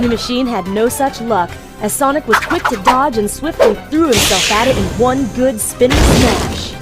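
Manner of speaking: usual speaking voice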